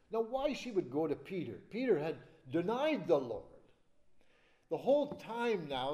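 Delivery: ordinary talk, nothing marked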